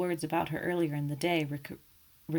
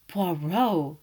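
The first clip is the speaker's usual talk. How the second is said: horrified cry; 6 dB louder than usual. A narrator's reading. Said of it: "I cried, horrified".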